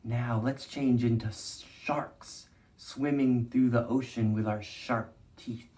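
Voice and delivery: soft, slow storytime voice; hushed, unhurried teacher leading a movement game; gentle guided movement. Acted. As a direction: guide the kids calmly through each shape.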